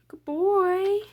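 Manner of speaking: high, loud cooing praise; delighted high-pitched coo; gushing pet praise. Pretend. Praising the ostrich in her drawing.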